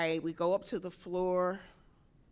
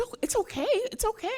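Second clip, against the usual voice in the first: higher soothing mock reassurance; imitating a sympathetic colleague, higher; consoling colleague; 5 dB louder, much faster than usual. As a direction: pat her on the back with your voice. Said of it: "She says".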